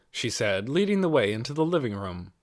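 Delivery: plain reading voice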